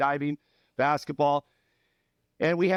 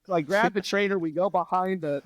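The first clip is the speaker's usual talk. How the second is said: ordinary talk, nothing marked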